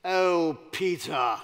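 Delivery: lofty British actor talking down, falling in pitch; mock-condescending British accent, projected loudly. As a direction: say it with patronizing British grandeur. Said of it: "a little bit condescending".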